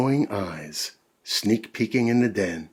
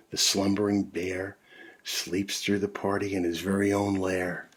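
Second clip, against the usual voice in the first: usual speaking voice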